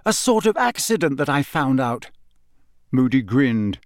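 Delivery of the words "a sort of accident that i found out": sharp. A narrator's reading. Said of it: "said Harry sharply".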